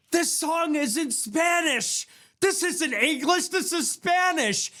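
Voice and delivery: high, slow wailing mock outrage; mock whiny viewer. Mocking fans upset the song is in Spanish, high slow wail. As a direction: whine it like an offended snowflake.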